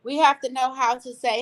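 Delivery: ordinary delivery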